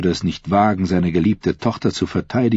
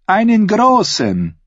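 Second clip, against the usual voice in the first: high laugh, then a slow, loud reply; chuckle, then draw the words out high; 6 dB louder, slower than usual. A narrator's reading. Lady-in-waiting accepts the queen's wine, laughing. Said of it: "Die mürrische Frau lachte".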